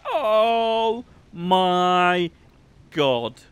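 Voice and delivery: loud, drawn-out exclamation starting high and dropping; delighted outburst. Real, not acted. Streamer overwhelmed by the little guys, delighted outburst. Delight at finding cute creatures.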